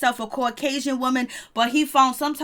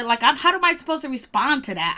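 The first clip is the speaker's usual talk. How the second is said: normal talking voice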